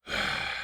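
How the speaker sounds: audible resigned sigh; heavy resigned sigh. Acted. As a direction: sigh before diving back in. Narrator sighs.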